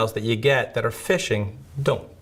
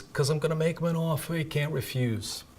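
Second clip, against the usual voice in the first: a bad, breathy-free mafia-don imitation, pitched up and hushed; horrible Godfather accent; 6 semitones higher, 5 dB quieter than usual. Instructor hamming a famous movie mobster line, bad Godfather accent. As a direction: lean into a clumsy Italian mobster voice, softer. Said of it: "I apologize to anybody for that horrible accent".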